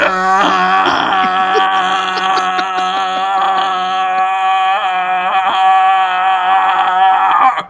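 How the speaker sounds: comically drawn-out wail; long held groan. Acted. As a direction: hold the noise on one note until breath runs out. Mimes the sung wish trailing off.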